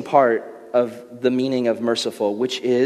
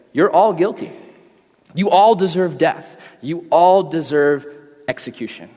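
stern judge; 3 dB louder than usual. Acted. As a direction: pronounce the sentence, then soften to love. Judge addresses courtroom of convicts.

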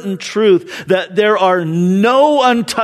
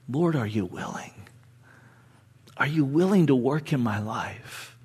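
quiet pleading prayer; 5 semitones lower, 8 dB quieter than usual. Retold or imitated. Voicing the prayers of many.

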